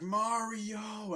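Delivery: high, long-held name; imitated call. Falling Mario retold calling out.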